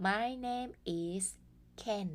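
My name is Ken drawn out slowly; teacher modelling slowly; slow model sentence. Shown as a demonstration. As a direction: spell it out slowly for learners. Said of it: "เคนพูดว่าอะไรบ้าง".

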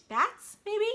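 high, loud eager guess; bright hammed-up wonder; excited guess. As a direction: guess like a thrilled kid. Guessing what hides in cave.

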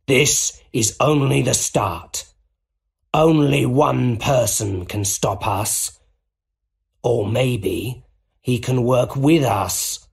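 slow, gloating villain voice; menacing Goblin. A narrator's reading. Goblin tells Osborn his plan, menacing. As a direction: draw out each threat like a villain. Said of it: "laughed the Green Goblin".

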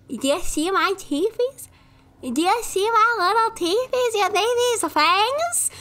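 put-on playful baby-talk brag; cutesy teasing. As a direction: coo about tiny fangs like showing them off. Showing off teeth cutely.

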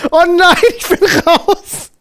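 ordinary delivery

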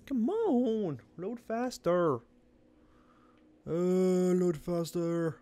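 words stretched very long; impatient whine. Real, not acted. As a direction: drag each word out, pleading with the loading screen. Impatient at game's slow loading.

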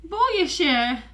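high, frightened little voice; scared dragon. Acted. Frightened little dragon hiding from the storm. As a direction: whimper it like a scared child.

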